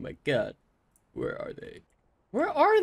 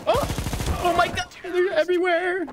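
panicked shriek. Real, not acted. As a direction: squeal it out in real alarm. Enemies suddenly appear.

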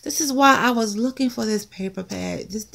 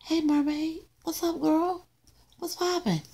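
put-on higher, softer playful girlfriend voice; mermaid voice; 4 semitones higher, 5 dB quieter than usual. Mermaid sticker greeting the phone, playful high voice. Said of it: "She's like".

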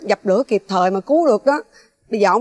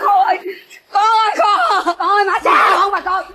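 crying out; 8 semitones higher than usual. Acted. Mother pleading with her son amid the brawl, wailing. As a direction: beg your child through sobs.

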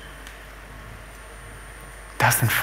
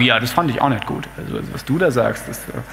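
reluctant disagreement; 7 semitones lower, 5 dB quieter than usual. Acted. Other friends voice doubts, trailing off.

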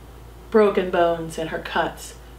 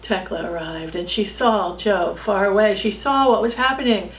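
usual speaking voice

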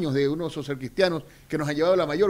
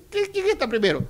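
mock mayor; 9 semitones higher than usual. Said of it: "Susanita se paró".

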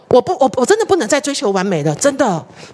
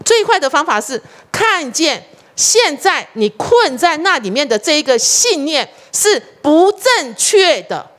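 slow emphatic repeat; 4 semitones higher, slower than usual. Real, not acted. Repeats key point more slowly.

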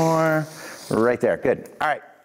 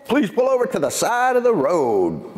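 police loudspeaker voice; 9 semitones higher than usual. Retold or imitated. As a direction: imitate a cop over the car speaker. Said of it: "gets on the microphone".

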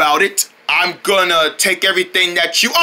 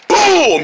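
boom; 6 semitones higher than usual. Pretend. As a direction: blow up the tank. Blowing up water tank.

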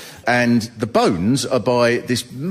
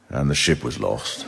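mock solemn refrain; 10 semitones lower, 4 dB quieter than usual.

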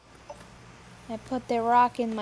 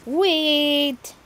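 calling out; 2 semitones higher, 8 dB louder than usual. Real, not acted. Calling out to friend.

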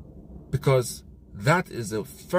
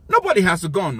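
louder, stressed Nobody; emphatic; 5 semitones higher, 6 dB louder than usual. Real, not acted. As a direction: punch Nobody loudly. Ranting about division.

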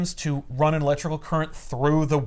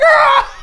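piercing high scream; 11 dB louder than usual. Retold or imitated. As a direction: let out a shrill shriek. Madam Web picked up.

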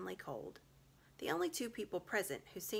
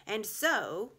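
louder, higher witch resuming; 4 semitones higher, 8 dB louder than usual. A narrator's reading. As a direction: press on with menace. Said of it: "continued the witch".

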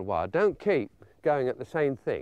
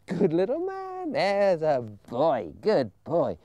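coaxing praise to a puppy; much slower than usual. Shown as a demonstration. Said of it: "get him to come right up to us".

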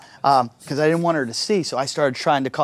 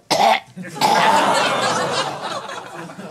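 imitated wheezy dog cough; dog asthma cough; 6 semitones higher than usual. Comedian imitating his dog's asthmatic cough. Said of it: "I hear my dog go".

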